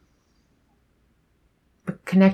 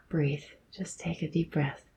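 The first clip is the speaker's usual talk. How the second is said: imitating a hushed, calming coach; very soft acted reassurance; soothing whisper; 11 dB quieter than usual. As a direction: whisper it softly to settle someone panicking. Movie character calms panicked woman.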